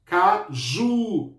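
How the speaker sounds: "caju" split up, with a long-held JU; stress on JU. Shown as a demonstration. Teacher repeating it for pupils, long stressed JU in "caju". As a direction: separate "ca", then draw out "ju" in "caju". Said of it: "O ju é a minha sílaba mais forte".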